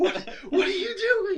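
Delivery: high, loud cry of disbelief; half-laughing yelled protest; mock alarmed yell. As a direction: play a comic panicked shout. Acting out panic at shooting tires.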